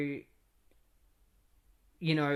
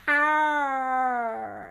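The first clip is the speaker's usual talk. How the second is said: high, drawn-out "aah" of pain; long high comic cry; mock pain scream; 10 semitones higher, 4 dB louder than usual. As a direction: yelp loudly as if shot, hammed up. Shot in the kneecap, retold.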